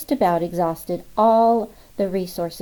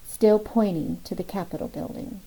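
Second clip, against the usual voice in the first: plain reading voice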